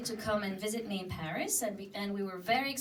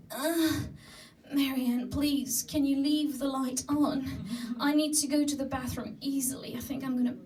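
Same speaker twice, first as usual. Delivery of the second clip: a slightly higher, lightly pleading whine; begging voice; 4 semitones higher than usual. Retold or imitated. Drunk friend begging Marianne to leave the light on, mild pleading. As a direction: beg lightly, as if desperate. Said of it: "the most begging voice ever".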